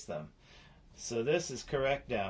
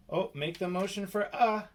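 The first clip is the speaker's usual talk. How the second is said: ordinary delivery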